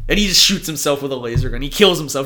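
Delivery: ordinary talk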